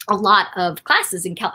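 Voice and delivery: ordinary talk, nothing marked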